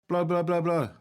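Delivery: loud, urgent coaching calls; urging calls. Acted. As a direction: push them to blow hard. Doctor urging patient to blow.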